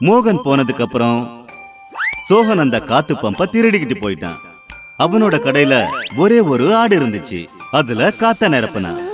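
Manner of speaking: plain reading voice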